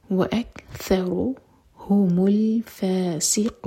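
الْفَاسِقُونَ recited with a whistling, hissing س; whistling seen. Shown as a demonstration. Teacher showing the whistling seen in recitation. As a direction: let the seen whistle in الفاسقون. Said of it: "سین پر سیٹی والی آواز".

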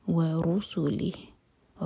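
ورسلہ slow, heavy ر, clear ہ at the end; heavy raa. Shown as a demonstration. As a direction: recite ورسلہ with a full ر. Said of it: "را heavy".

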